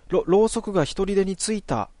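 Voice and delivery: high, stammering alarm; frightened stammer. Acted. Natsumi seeing a candle light itself, high and frightened. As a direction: stumble on the words, spooked.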